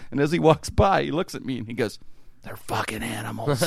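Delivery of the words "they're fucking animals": softer, mock-wounded magician; imitated hurt muttering; near tears. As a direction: complain like a beaten performer. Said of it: "looks like he's in tears".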